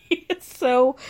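laughing while saying it, slow; real laughter at how nasty he is. Real, not acted. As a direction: laugh it out slowly. Laughing at Stan's nastiness.

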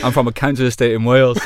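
loud, real laugh through the words. Real, not acted. Guest confesses his real origins to host, laughing. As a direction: crack up while getting it out. Cracking up while dropping the act.

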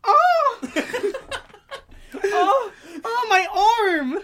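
high, loud cry of pain through laughter; mock pained cry. Spider-Man gets hit by a blade, a hammed-up yelp. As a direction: ham up getting cut in the arm. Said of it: "and he goes".

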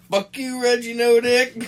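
high, loud, drawn-out yell; high-pitched hammed-up shouting; mock furious shout. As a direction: belt out the insult in mock outrage. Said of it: "They were both like".

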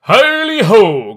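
put-on loud, hearty jolly old boss; jovial fat voice. A narrator's reading. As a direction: call out rich and jovial, slowly. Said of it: "cried old Fezziwig".

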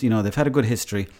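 normal talking voice

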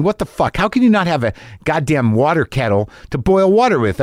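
ordinary talk

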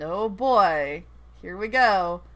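ordinary talk, nothing marked

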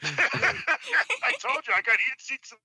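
ordinary talk, nothing marked

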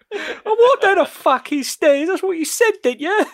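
high, loud Cockney impression; Gary Oldman. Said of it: "What a good accent".

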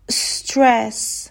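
استرس begun on a long hiss running into "tress"; drawn-out initial s. Shown as a demonstration. Teacher demonstrates joining s to next letter, slow stretched s. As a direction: slowly stretch the s of استرس, no vowel before it. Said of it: "س رو بچسبونیم به حرف بعدی".

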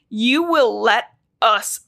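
slow, grave command to let them pass; Gandalf command. Retold or imitated. Blue Star commanding the patrol: slow grave command. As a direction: hold the line like Gandalf, slowly. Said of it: "Like Gandalf kind of thing".

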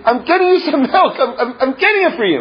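high, hurried reassurance, mildly frantic; mock-exasperated new parent; harried parent. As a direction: plead that you're getting it, pitch up and rushing. Frantic parent answering crying baby.

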